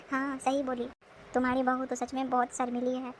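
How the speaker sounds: normal talking voice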